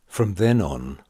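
usual speaking voice

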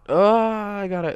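drawn-out, high "oh"; excited exclamation. Real, not acted. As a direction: exclaim it loudly, stretching the first word. Exclaims while playing the game.

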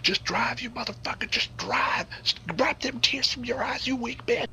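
high, frantic mock yell at the driver; panicked high-pitched order to drive. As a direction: push her to floor it in a high panic. Yelling at her to drive.

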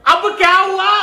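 loud irritated question; acted fed-up son shouting; exasperated shout. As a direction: yell back at yet another interruption. Son snaps at another call.

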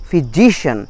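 "physician" as "fi-JI-shun"; stress demo. Shown as a demonstration. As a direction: hit the middle syllable of "physician". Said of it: "একটা stress পড়লো".

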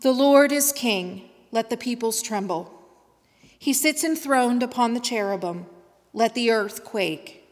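normal talking voice

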